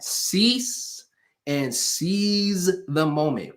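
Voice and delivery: "Cease" high and long, then a pause; slow emphatic catchphrase. Shown as a demonstration. Speaker dictating for the chat, "Cease" high, "seize the moment" slow.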